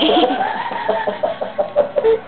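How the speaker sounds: shrill giggle fit; real high-pitched laughter; high squealing laugh. Real, not acted. Speaker cracks up at own joke.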